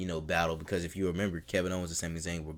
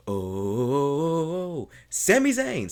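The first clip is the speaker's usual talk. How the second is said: imitating the announcer; 7 semitones higher, 6 dB louder, much slower than usual. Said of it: "And then you hear".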